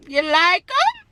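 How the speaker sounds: high, slow "You like 'em?" with a teasing rise; showing off. Real, not acted. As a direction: drag out "You like 'em?", fishing for praise. Showing off her new lashes.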